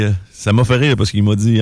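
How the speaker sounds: ordinary delivery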